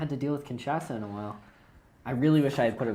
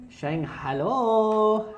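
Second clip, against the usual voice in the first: surprised hello; 9 semitones higher, 5 dB louder, much slower than usual. Real, not acted. Reacting to drawn Shanghai card.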